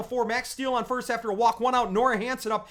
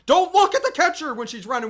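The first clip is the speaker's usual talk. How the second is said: mock yell; 5 semitones higher, 7 dB louder than usual. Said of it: "you'd hear me yelling".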